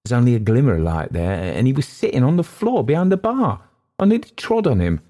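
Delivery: high, rattled waiter's account; frightened waiter. Acted. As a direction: pitch climbing as the fright returns.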